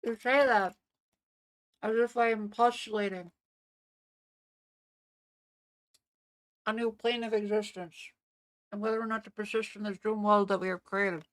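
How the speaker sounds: talking through a mouthful of peanut butter; mouth full of peanut butter. Real, not acted. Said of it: "I just have a mouthful of peanut butter".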